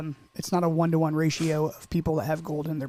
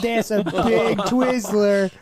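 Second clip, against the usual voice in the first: drawn-out mock awe; 4 semitones higher, 6 dB louder, much slower than usual. Mock-impressed about Twizzler.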